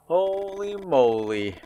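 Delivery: high, slow, stretched-out astonishment; amazed exclamation. Real, not acted. Player stunned as the next chapter begins, drawn-out amazement. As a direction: draw out both words high in amazement.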